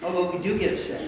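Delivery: soft, low aside; low and understated, under the breath; subdued admission. Shown as a demonstration. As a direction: drop to a quiet, rueful confession. First take, an aside before repeating.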